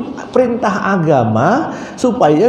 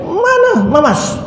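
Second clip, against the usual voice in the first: thief bosses the wife about watch duty; scolding; 9 semitones higher than usual. Retold or imitated. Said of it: "Dimarah-marah". (omelan tinggi pura-pura)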